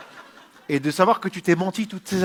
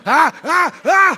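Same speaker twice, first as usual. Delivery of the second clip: hammed-up moans; 13 semitones higher, 12 dB louder, much slower than usual. Acted orgy moans.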